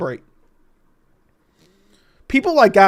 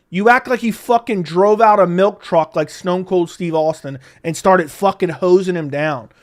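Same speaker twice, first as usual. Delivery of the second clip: usual speaking voice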